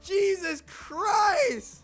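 high shrieks then slow stunned shouting; scared scream. Real, not acted. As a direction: scream from a sudden fright. Jump-scared in game.

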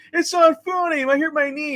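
put-on high whining child voice; whiny kid. Billy complaining to Rutger Hauer, high mock whine. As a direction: whine like a hurt little kid. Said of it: "He's like".

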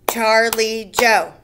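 "Charlie Joe" in three loud, even beats; split into syllables. Shown as a demonstration. Teacher doing pupils' names, "Charlie Joe" beat by beat. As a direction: punch out char, lie, joe one by one. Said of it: "How many syllables?".

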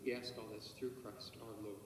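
ordinary delivery

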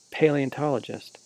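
normal talking voice

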